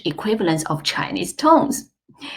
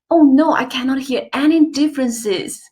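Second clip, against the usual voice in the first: higher, mock-dismayed learner; worried student; 7 semitones higher than usual. Teacher voicing doubting students, mock dismay. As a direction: play a learner in mild despair. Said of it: "some of you might think that".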